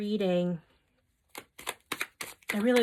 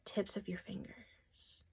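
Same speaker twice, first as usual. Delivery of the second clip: slow, much softer whisper on "tips of your fingers"; whispered emphasis; 13 dB quieter, slower than usual. Shown as a demonstration. Reader repeats Spirit's emphasis to viewers in a slow whisper. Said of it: "Emphasis from Spirit".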